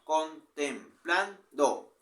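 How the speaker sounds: very slow, stretched word; slow syllable count. Shown as a demonstration. Teacher counting the four syllables of the short verse, slowly.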